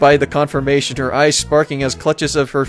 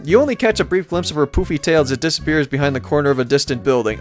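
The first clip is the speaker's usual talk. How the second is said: usual speaking voice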